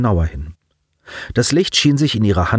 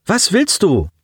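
mildly brusque, higher-pitched challenge; a touch of rudeness, pitched up; gruff retort; 10 semitones higher than usual. A narrator's reading. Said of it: "fuhr er sie ruppig an". (leicht ruppig angefahren)

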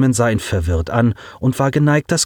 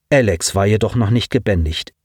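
plain reading voice

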